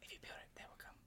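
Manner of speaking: hushed movie-quote whisper. Pretend. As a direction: breathe it like a secret. Quoting the movie's famous whisper.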